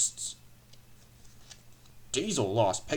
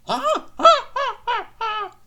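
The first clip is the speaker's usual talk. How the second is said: very high, loud staccato "ha ha"; guffawing trucks; 12 dB louder, slower than usual. A narrator's reading. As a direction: cackle in squeaky separate bursts. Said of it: "gruffled the trucks".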